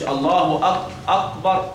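slow أكبر with the k pulled back toward q; wrong qaf instead of kaf. Shown as a demonstration. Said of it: "ما تقولش".

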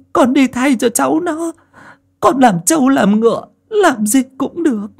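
desperate pleading. A narrator's reading. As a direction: beg on your knees for your son. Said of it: "lạy lục van xin".

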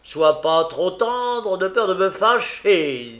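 loud, pompous brush-off; mock dignity. A narrator's reading. Noé repousse Charlotte, avec une dignité hautaine et forte. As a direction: push her away with puffed-up dignity. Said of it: "avec dignité".

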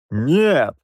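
a curt, rude refusal; rude snap. A narrator's reading. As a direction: snap it back at him. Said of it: "грубо огрызнулся я".

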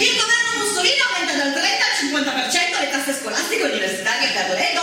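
loud, ringing announcement of each decree; loud proclamation. Acted. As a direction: proclaim each date like a town crier.

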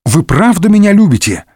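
high-pitched, mock-tearful question; a touch of whimpering hope; tearful pleading. A narrator's reading. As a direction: plead as if about to sob, lightly acted. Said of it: "всхлипнул Д'Артаньян".

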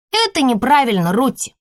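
put-on high scolding little girl; bossy older child. A narrator's reading. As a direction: correct the toddler. Girl correcting toddler. (поучающий детский голос)

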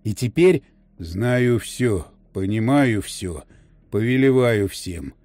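low, slow, indifferent voice of a possessed man; detached alien voice. Acted. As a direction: speak from far away, without feeling. Blink's possessed voice. (бесстрастный чужой голос)

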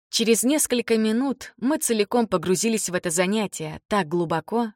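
plain reading voice

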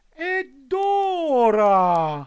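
high, slow fox voice, sweetly cunning; the fox coaxing the puppet; sly fox. A narrator's reading. Fox asks about coins.

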